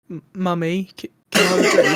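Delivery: lightly voiced whiny child, stammering; a hint of a small kid asking mum, rushed; little kid. Kid in the back seat asking mummy for a drink, lightly voiced. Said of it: "One of the kids goes".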